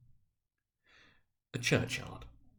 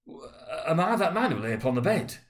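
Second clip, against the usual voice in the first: higher, horrified cry; 7 semitones higher, 4 dB louder than usual. Acted. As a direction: cry out on your knees in dread. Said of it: "he cried upon his knees".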